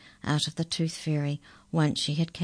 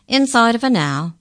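much higher, louder confirmation; emphatic; 7 semitones higher, 9 dB louder than usual. A narrator's reading. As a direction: confirm it loudly and firmly. Incisor repeats confirmingly.

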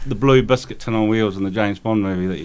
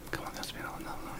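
whisper; 19 dB quieter than usual. Real, not acted. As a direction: whisper the hint under your breath. Whispered hint during quiz.

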